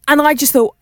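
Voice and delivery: usual speaking voice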